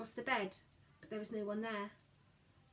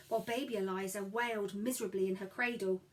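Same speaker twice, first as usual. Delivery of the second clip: plain reading voice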